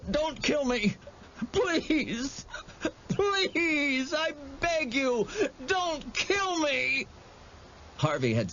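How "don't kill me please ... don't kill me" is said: high, tearful begging, slowing at the end; begging for mercy. A narrator's reading. Kneeling boy begging the monster for mercy, tearful. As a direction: plead for your life, voice cracking. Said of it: "begging for mercy".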